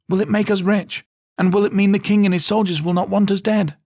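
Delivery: put-on high, loud girl's voice, eager. A narrator's reading. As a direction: fire questions like an excited kid. Isara asks eagerly.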